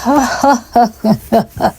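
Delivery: mocking staccato laugh; put-on string of ha-ha-has; imitated man's laugh. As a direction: laugh like the man in the dream. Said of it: "the man laughs".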